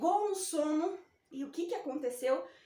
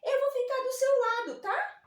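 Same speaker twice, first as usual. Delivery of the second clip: comforting letter O; 8 semitones higher than usual. Pretend. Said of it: "E falou assim".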